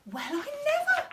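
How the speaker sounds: high surprised exclamation; astonished exclaim. Acted. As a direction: gasp it out in amazement. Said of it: "exclaimed Celia".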